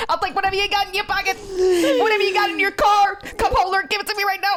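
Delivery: high, rapid mock stick-up; fast, high-pitched, badly faked robber; put-on demanding voice. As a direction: squeak out demands in a rush. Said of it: "I'll do the voice for that".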